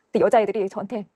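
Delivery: usual speaking voice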